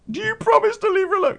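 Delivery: high, fast mock mother voice; a put-on high demand from the mum; imitating her mum. The mum demanding a promise over the phone, high and fast.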